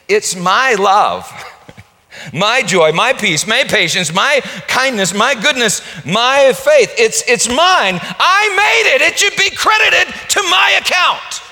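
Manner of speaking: shouted high, boasting self-righteous voice; mock proud boast. Preacher mocking sinners boasting before God, shouted. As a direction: crow every my like a proud fool.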